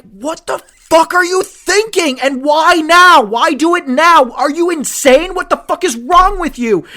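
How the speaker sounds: high, loud, outraged at the quarterback; exasperated rant. Retold or imitated. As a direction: yell at the player in disbelief.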